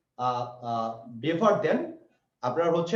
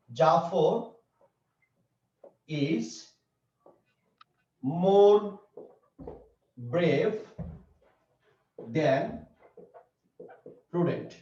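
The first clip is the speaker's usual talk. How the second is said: word-by-word dictation; much slower than usual. Shown as a demonstration. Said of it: "আমি যদি এরকম বলতাম".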